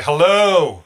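loud, high call into a cave. Acted. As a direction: call hello into the dark. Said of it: "I'm gonna say".

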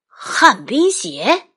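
slow, drawn-out disbelief; surprised. Acted. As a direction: repeat it in shock. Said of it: "爸爸说".

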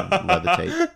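mystical voice. Acted. Mystical turtle line.

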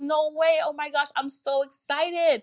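high, bright-pitched thrilled client; excited squeal. Retold or imitated. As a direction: gush like a thrilled friend. Client's thrilled reaction, voiced.

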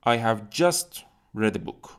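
slow, careful English example; slow dictation reading. Shown as a demonstration. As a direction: spell the sentence out slowly for learners. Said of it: "مثلاً میگین که".

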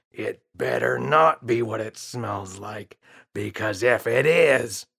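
put-on dad glaring at the boy; threatening father.

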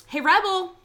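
high, loud, fast mock shout; mock yell at Rebel. Movie fan yelling at Rebel Wilson's cat, loud mock outrage. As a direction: yell at the character on screen.

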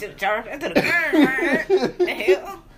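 talking through laughter; words bubbling with laughter; laughing. Real, not acted. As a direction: laugh while saying it. Friends laughing at grocery story.

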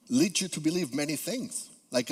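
normal talking voice

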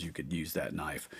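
whispered aside; hushed knowing aside. Real, not acted. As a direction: drop to a conspiratorial whisper. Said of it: "whispered aside".

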